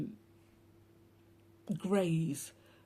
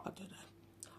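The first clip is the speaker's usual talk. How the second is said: muttered aside; 2 semitones higher, 17 dB quieter, slower than usual. Real, not acted. Aside after mock channeling.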